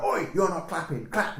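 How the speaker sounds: high sharp scolding; bossy telling-off. Retold or imitated. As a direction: bark at the crowd to clap. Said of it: "she'd go".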